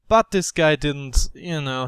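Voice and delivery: ordinary talk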